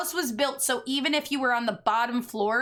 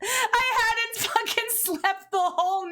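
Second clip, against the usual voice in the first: whiny exhausted; 9 semitones higher than usual. Retold or imitated. Pleading exhausted in high voice.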